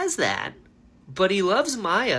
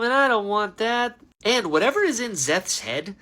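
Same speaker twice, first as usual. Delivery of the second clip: ordinary talk, nothing marked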